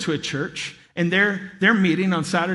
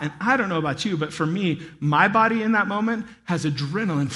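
ordinary delivery

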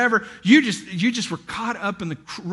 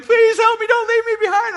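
much higher, louder hammed-up plea; mock desperate wife; 14 semitones higher, 6 dB louder than usual. Wife pleading with her husband in the crowd, high mock plea. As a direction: cry out like you're being left behind.

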